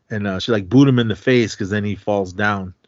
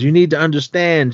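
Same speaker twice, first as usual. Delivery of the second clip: higher, louder imitated plea; mock desperate insistence, voice raised; retold urgent pleading; 7 semitones higher, 6 dB louder than usual. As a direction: act out insisting over someone's yelling. Attacker pleading over her screams.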